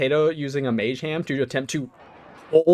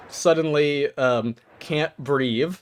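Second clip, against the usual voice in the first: ordinary talk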